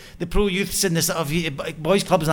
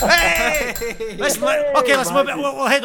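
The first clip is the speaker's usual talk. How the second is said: laughing protest; 8 semitones higher, 6 dB louder, slower than usual. Real, not acted. Host laughs at the jab.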